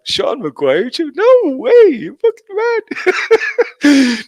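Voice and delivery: very high, hammed-up protest; mock disbelief. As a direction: squeal it in pretend incredulity.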